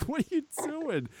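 high-pitched question through laughter; laughing disbelief. Real, not acted. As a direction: laugh while asking in disbelief. Laughing at dirty nails.

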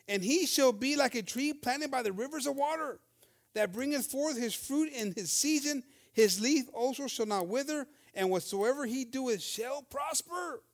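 a preacher reading psalm verses aloud, slowly; scripture reading. Acted. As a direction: proclaim the verse with weight. Reads verse three aloud.

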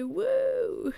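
high cheer; woo. Real, not acted. Celebrating her birthday, high cheer. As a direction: whoop for the birthday.

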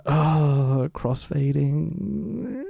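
very drawn-out, rising mock groan of words; mock reaction. Podcaster reacting to a crossfade in a film, mock reaction. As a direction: stretch it out slowly, climbing as you go. Mocking a corny film transition.

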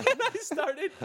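words broken by laughter; laughing. Real, not acted. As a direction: crack up mid-sentence. Laughing at his own prank.

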